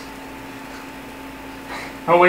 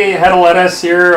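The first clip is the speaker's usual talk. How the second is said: louder, punchy host announcement; hammy presenter intro; 5 dB louder than usual. Acted. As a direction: boom out the show name. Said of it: "dramatic reading".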